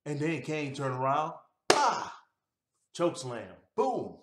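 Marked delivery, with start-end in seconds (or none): 1.7-2.2 short, high, loud "ah"; attack cry. Retold or imitated. Recapping Kane turning around to attack, sudden loud cry. 3.8-4.2 high, punched-out "boom"; impact boom. Retold or imitated. Recapping a choke slam to viewers, explosive high boom.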